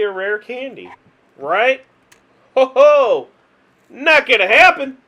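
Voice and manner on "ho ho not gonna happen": loud, higher sarcastic "ho ho"; mocking laugh. Real, not acted. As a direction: scoff at the bad luck out loud. Mocking own bad luck.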